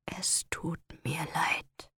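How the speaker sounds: slow, very soft whisper. Acted. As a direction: barely breathe the apology. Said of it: "Er wisperte es so leise".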